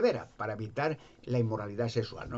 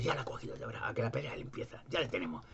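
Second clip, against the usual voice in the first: hushed, breathless scheming; conspiratorial whisper; 7 dB quieter than usual. Retold or imitated. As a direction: whisper it like plotters in the crowd. Pharisees whisper to each other.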